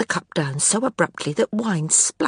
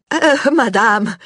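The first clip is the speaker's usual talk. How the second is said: higher, louder, slow frightened stammer; stuttering; 6 semitones higher, 7 dB louder, slower than usual. A narrator's reading. Knight caught mocking the empress, stammering higher and louder. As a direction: stammer it out in panic. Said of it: "he stuttered".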